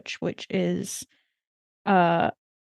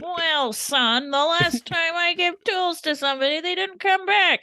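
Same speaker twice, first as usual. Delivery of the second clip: put-on old man voice; 9 semitones higher than usual. Dale voiced grudging about tools.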